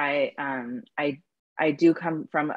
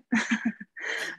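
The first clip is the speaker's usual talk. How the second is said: breathy laugh; 9 semitones higher, 3 dB quieter than usual. Real, not acted. Laughing at own story.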